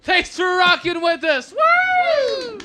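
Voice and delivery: loud whooping sign-off; excited shout. Real, not acted. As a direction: cheer it out, high and loud. Host signing off, cheering.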